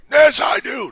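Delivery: high, shouted mock complaint; mock protest. Election denier insisting to a doubter, high mock shouting. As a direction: yell it like a stubborn objector. Acted quarrel over proof.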